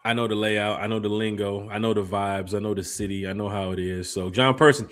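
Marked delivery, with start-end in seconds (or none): none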